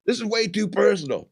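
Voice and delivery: high, whiny mock objection; offended protest. Roasted man repeats his protest.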